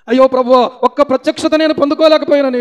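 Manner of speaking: loud, high mock wailing prayer; imitated tearful plea; weeping prayer. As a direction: cry out to God in lament. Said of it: "అని ఏడుపు".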